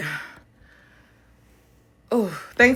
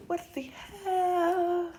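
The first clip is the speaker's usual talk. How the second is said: slow, high, stretched what the hell; drawn-out disbelief; 12 semitones higher, 5 dB quieter, much slower than usual. Real, not acted. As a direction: drag it out, baffled. Second drawn-out take.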